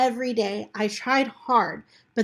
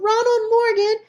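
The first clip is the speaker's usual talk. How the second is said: yell; 12 semitones higher, 6 dB louder than usual. Acted. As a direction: call across the field to stop him. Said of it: "And Tom yelled".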